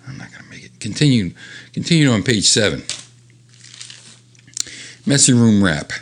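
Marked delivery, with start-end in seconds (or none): 0.1-0.8 whispered mock despair; whispering mock defeat to the viewers. Real, not acted. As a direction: breathe it out, defeated. Rushing reading, mock despair.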